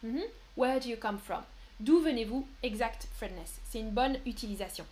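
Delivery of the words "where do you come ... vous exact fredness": ordinary talk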